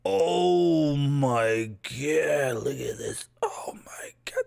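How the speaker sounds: breathless drawn-out awe; awed gasp. Real, not acted. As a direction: swoon over it. Overwhelmed by sword.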